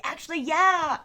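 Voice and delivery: mock-casual "sure" with a grin; cheeky yes. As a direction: pounce on the offer gleefully. Cheekily accepting friends' offer.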